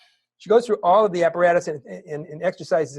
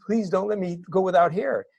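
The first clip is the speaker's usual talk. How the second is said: imitating a tearful little girl, a bit higher; mild tearful little-girl voice, pitched up; child's crying plea; 4 semitones higher than usual. Niece begging her uncle not to go without hair, mildly tearful. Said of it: "and she's crying".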